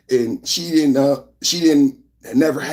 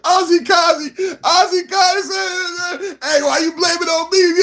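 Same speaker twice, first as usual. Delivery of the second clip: laughing read. Real, not acted. Reading chat while laughing.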